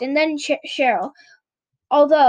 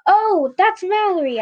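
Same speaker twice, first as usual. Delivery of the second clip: calling out; 6 semitones higher, 3 dB louder than usual. Acted. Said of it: "I cried".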